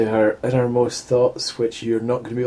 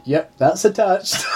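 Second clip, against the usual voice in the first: slow, higher, laughing through it; deadpan laughing quote; 7 semitones higher, slower than usual. Retold or imitated. Quoting the woman's inner thought, slow and laughing. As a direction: drawl it, barely holding the laugh. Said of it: "Or my favourite one".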